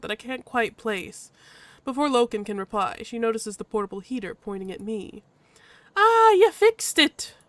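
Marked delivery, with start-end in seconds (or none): 6.0-7.3 high, loud, perky Irish-tinged voice; drawn-out delight in a vague Irish accent; vaguely Irish accent. Acted. Badger notices the heater, perky vaguely Irish accent. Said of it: "decided the badger's vaguely Irish".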